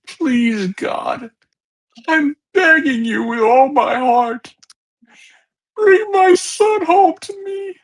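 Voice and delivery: high, hoarse, sobbing prayer; a mother begging God for her lost son; desperate sobbing mother. Acted. Mother praying on a tape for her missing boy, desperate sobbing. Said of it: "You can hear the exhaustion in it".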